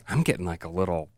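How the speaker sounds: low, soft, halting aside; quietly moved. Retold or imitated. Dad recalling getting misty reading to his son, hushed. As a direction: let it drop low and quiet.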